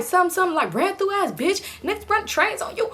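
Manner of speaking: high-pitched heated insults; going off. Retold or imitated. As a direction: rip into her, voice up high. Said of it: "she was really going off on her".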